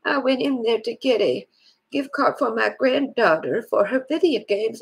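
ordinary talk, nothing marked

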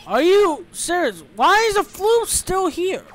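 high, loud, slow disbelief; drawn-out, high-pitched frustration; exasperated yelling. Real, not acted. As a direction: stretch out the indignant question loudly. Exasperated that creature is back.

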